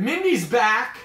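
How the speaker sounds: excited, high and loud; sudden burst of welcome; real delighted announcement. Real, not acted. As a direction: call it out happily. Wife returns to the stream.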